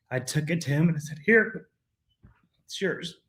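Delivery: fighting back tears, then a shaky laugh; tearful. Real, not acted. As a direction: voice breaking high as he hands over the ring. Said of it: "Already, man".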